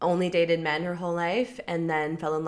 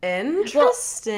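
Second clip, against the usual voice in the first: slow, loud, intrigued; intrigued drawl; 6 semitones higher, 7 dB louder than usual. Real, not acted. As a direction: stretch it out intrigued. Drawn-out intrigued reaction.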